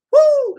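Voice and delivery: very high, loud whoop; delighted cheer; excited woo. Real, not acted. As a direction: let out a thrilled whoop. Excited about the song.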